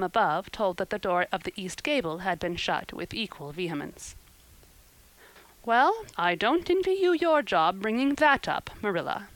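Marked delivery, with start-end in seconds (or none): 5.6-9.3 higher, weighty pronouncement; solemn, affronted verdict; unspeakable solemnity. A narrator's reading. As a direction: pronounce it with grave disapproval. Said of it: "said Missus Rachel with unspeakable solemnity".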